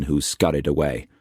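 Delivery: ordinary delivery, nothing marked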